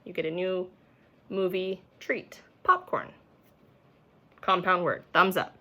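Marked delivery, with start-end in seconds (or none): 2.6-3.1 high reveal popcorn. Shown as a demonstration. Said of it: "put it together".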